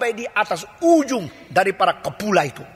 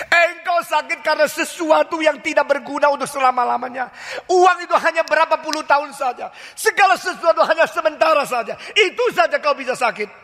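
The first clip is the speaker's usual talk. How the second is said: preacher at full force; fiery preaching; 8 semitones higher than usual. Acted. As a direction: hammer the rebuke home to the congregation.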